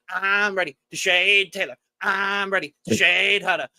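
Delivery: high, eager cartoon sponge voice; squeaky SpongeBob chant, high and bouncy. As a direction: chirp it like an overexcited cartoon sponge. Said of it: "It felt like SpongeBob".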